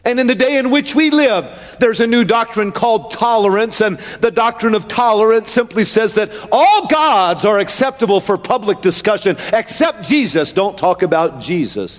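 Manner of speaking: emphatic pulpit delivery; full-force preaching. Real, not acted. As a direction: preach it steadily in measured, rhythmic phrases.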